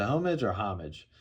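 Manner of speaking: first "homage" HOH-mij, second "homage" HAH-mij; two pronunciations contrasted. Shown as a demonstration. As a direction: weigh "homage" with long "oh" against "homage" with open "ah". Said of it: "Is that how you say it?".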